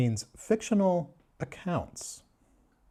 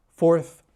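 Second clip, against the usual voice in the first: fourth said louder and higher; punched word; 4 semitones higher, 8 dB louder than usual. Acted. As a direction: hit fourth like a shocking fact. Mock-shocked age reveal.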